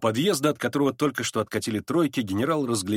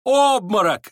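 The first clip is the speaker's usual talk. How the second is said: loud, high cry of alarm; crowd shouting; 9 semitones higher, 7 dB louder, slower than usual. A narrator's reading. The onlookers cry out as Ганя falls, shouting. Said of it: "закричали кругом".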